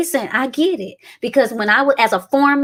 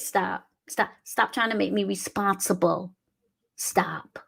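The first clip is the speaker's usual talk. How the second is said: a soft unvoiced stop; whispered stop; 4 semitones lower, 8 dB quieter, slower than usual. Real, not acted. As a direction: barely breathe the word.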